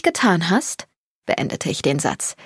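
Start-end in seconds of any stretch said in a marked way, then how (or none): none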